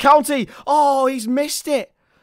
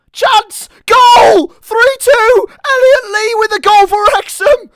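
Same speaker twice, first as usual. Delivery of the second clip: screaming the winner, much higher and louder; goal scream; 9 semitones higher, 7 dB louder, slower than usual. Real, not acted. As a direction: lose it completely as Wrexham go ahead. Wrexham score late winner.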